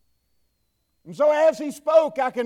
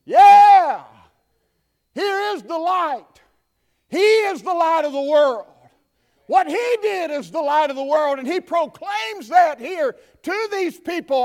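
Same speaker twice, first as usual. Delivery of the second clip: loud, high shouted preaching; full-force preaching; 5 semitones higher, 8 dB louder than usual. Acted. Preacher rousing the congregation, full-force shouting. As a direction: preach it out to the whole church.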